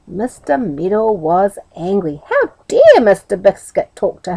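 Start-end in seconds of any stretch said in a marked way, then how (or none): none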